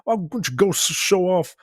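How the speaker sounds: high snippy put-down; hammed-up rebuke of the clerk; mock scolding. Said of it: "she's like".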